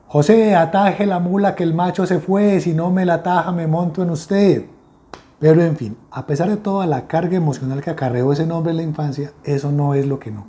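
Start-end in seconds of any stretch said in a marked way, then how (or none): 0.1-4.7 teasing rhyme cried out to a crowd; street-cry taunt. Acted. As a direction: call it out like a pregón. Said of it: "con el siguiente pregón".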